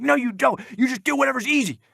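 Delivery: high, quick mock-screechy retort; imitated temper tantrum. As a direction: throw her accusation back in a squealed tantrum voice. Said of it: "literally no joke like a temper tantrum".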